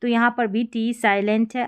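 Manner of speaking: ordinary talk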